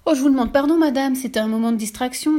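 high, loud, panicky pleading; flustered apology. Retold or imitated. Caught teller apologizing to the witch in panic. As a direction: beg forgiveness in a fluster. J'essaye de m'excuser.

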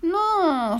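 high, drawn-out whine; moaning.

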